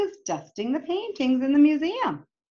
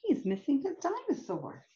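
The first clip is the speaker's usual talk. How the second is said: ordinary delivery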